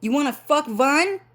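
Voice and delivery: high, squeaky mock voice of the fake dad; imitating Trio. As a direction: mimic the stepdad in a put-on high voice. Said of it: "He's like".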